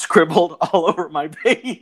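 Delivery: amused, laughter creeping into the words; laughing while talking. Real, not acted. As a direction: break into laughter mid-sentence. Laughs while telling the scribbling story.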